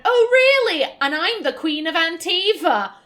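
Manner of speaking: high, loud mocking scoff; guard mocking the Warden, sarcastic and loud; sarcastic disbelief. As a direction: scoff at the claim, pitch jumping up. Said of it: "basically like".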